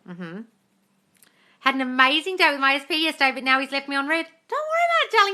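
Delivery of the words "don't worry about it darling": reassuring. Real, not acted. Reassuring a worried viewer.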